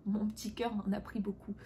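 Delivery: soft whispered aside through a laugh. Real, not acted. Vlogger confiding her hurt to viewers, whispered and laughing. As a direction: breathe it out with a wry little laugh. Self-pitying aside after hurtful story.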